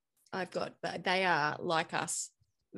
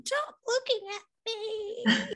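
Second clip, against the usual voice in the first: put-on much higher, slow, whiny child's plea; squirming child; slower than usual. Daughter begging her mother to stop staring, high whine. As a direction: whine it like a squirming six-year-old.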